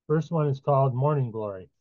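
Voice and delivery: normal talking voice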